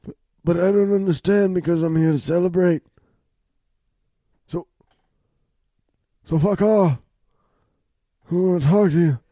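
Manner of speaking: lightly raspy mafia don; a hint of a Godfather boss; mob boss voice. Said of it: "This is not a mobster accent".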